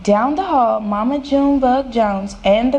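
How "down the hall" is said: ordinary delivery, nothing marked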